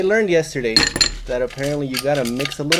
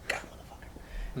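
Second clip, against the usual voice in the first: strained whisper, slow; whispered curse; 21 dB quieter, slower than usual. Retold or imitated. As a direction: hiss it out as the burn hits. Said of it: "It's like".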